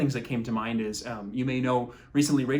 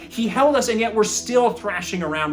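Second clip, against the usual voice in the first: loud, higher preaching climax; preaching at full force; 7 semitones higher, 6 dB louder than usual. Acted. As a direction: drive it home to the congregation.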